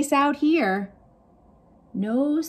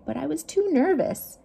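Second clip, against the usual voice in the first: ordinary delivery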